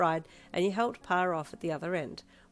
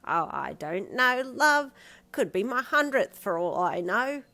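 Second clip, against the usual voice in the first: put-on slow, higher old man voice; 6 semitones higher, 7 dB louder, slower than usual. A narrator's reading. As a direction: drawl it like a cheery granddad. Pa's voice.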